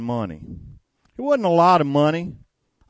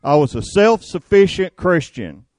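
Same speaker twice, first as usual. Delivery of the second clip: louder confession, pitch up; fervent preaching; 5 semitones higher, 6 dB louder than usual. Real, not acted. Preacher confesses to congregation, loud and fervent. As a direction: drive the confession home.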